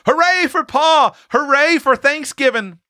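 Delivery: put-on very high boyish hurrahs; roared cheer. A narrator's reading. Small boys greet father, high jubilant cheering. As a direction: cheer in a high, stretched child's voice. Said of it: "the small boys roared".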